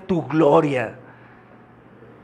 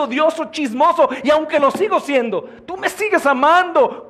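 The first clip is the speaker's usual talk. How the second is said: fervent praise; 9 semitones higher than usual. Shown as a demonstration. Fervent worship prayer voiced.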